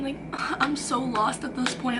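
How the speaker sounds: ordinary talk, nothing marked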